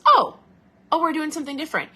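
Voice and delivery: high, loud startled "oh" that settles; body surprised. Retold or imitated. The body reacting to a diet change, startled and loud. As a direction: play the body caught off guard by a change. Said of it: "So your body goes".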